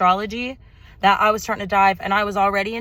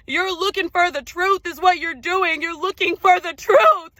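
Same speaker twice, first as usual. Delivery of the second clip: building fervour; 7 semitones higher, 3 dB louder than usual. Real, not acted. Rising emotional spiritual monologue.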